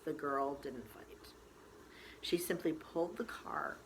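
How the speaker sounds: plain reading voice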